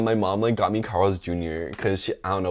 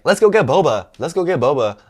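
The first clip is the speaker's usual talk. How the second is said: high, loud eager chirp; mock-excited insistent begging; pestering sister; 7 semitones higher, 6 dB louder than usual. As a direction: imitate a sibling nagging to go out.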